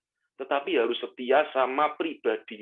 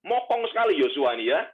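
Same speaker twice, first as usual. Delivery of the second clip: normal talking voice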